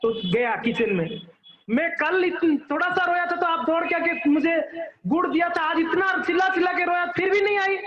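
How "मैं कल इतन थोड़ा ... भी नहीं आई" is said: higher-pitched aggrieved child, lightly voiced; mock complaint, pitched up; child's complaint. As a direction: retell a child protesting to mother. Child complains to mother in story.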